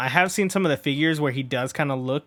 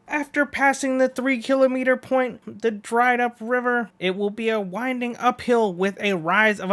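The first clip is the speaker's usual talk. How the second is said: high, bright announcer read of the course; race announcer; 10 semitones higher, 3 dB louder than usual. Acted. Announcer laying out the race course for the crowd, upbeat. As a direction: call it out like a stadium announcer.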